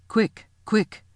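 urgent commanding shout. A narrator's reading. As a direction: call it out with urgency. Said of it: "shouted the voice of Aslan".

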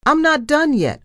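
high, loud call after someone; shouted. A narrator's reading. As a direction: call out insistently to stop someone leaving. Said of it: "I shouted after her".

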